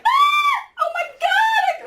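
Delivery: very high, loud panicked shriek; acted terrified scream. Acting out terror at lightning.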